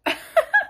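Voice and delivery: high burst of laughter; sudden laugh. Real, not acted. Bursts out laughing mid-intro.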